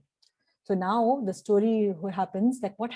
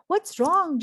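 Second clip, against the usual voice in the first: loud, higher question; yelled; 9 semitones higher, 5 dB louder than usual. A narrator's reading. James calling down to Sally, yelled. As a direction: call down worried. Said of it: "James yelled".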